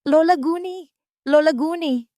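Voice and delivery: high, loud call across the garden. A narrator's reading. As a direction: call to her excitedly from afar. Alona calls while running. (masiglang pagtawag, mataas at malakas)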